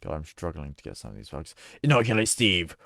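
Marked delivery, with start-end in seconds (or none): 1.8-2.8 mock command. Commanding order in the game.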